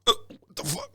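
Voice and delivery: imitating a dying man's disbelief; dazed surprise. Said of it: "he just had this surprised look".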